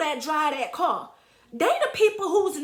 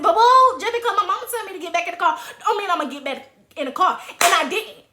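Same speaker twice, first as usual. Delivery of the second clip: usual speaking voice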